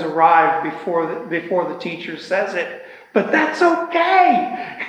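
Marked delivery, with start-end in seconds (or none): 3.1-4.8 much higher, drawn-out reassurance; playful high lilt. Real, not acted. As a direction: stretch it out high, about to laugh. Joking reassurance, then laughs.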